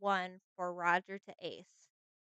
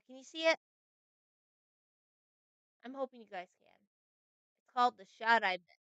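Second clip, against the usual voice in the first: normal talking voice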